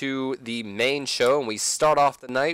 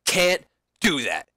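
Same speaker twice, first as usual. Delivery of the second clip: mock angry, slow ending; angry Seth; 4 semitones higher, 5 dB louder than usual. Seth answering Sting, mock angry. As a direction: spit it back at him. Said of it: "Seth then responds".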